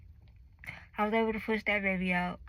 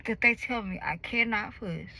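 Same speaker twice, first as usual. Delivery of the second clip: ordinary talk, nothing marked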